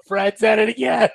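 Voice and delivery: high playful callout; mock callout, corrected name. Correcting himself to say Fred, high playful callout. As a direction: sing out the fixed name with glee.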